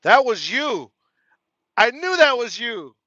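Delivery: loud, high burst of delight; excited recognition. Real, not acted. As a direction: shout it out, thrilled to recognize him. Recognizes the gift sender in chat.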